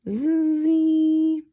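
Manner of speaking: high imitated snore; mock snore; snoring. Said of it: "He was snoring loudly".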